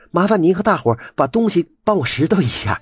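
high, fast urgent asking a neighbour; a wife rushing off to hospital, asking help; hurried request. A narrator's reading. Chunzao hurriedly asks Auntie Wu. (春枣急匆匆托付吴婶儿)

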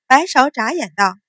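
plain reading voice

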